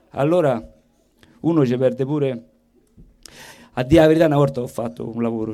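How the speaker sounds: normal talking voice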